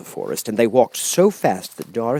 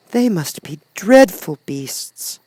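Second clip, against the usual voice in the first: put-on higher, slow girlish voice; a girl shuddering at the thought; lightly voiced young girl; 9 semitones higher, 4 dB louder, slower than usual. A narrator's reading. Dorothy's voice.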